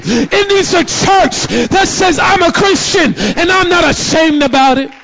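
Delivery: loud, driving sermon voice; preaching. Acted. Pastor preaching boldly to his congregation, full force.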